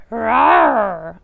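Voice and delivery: bear roar. Acted. Old Bear roaring his answer about latkes. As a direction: roar like a bear wanting jam. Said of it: "Old Bear growled".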